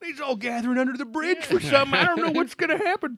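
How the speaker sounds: put-on high, fast old prospector voice. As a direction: hoot it like a crusty gold miner. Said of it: "I went, I went old prospector".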